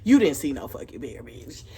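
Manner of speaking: calling out cousin's bear claim, scoffing; mock accusation. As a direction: laugh her story off loudly. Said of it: "We all looking at her like".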